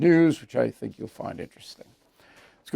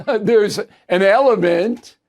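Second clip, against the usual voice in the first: louder, slow start through a laugh; amused chuckle; 5 semitones higher, 5 dB louder, slower than usual. Real, not acted. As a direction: chuckle into the answer. Chuckles answering a letter.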